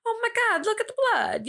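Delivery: high-pitched showman with a put-on accent; crowd-pleasing showman. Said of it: "I don't know why he has an accent".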